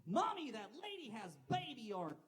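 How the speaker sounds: put-on high, loud child blurting it out; shouting little kid. Kid on a train to his mom, shouting. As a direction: call out like a tactless child. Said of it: "He shouts".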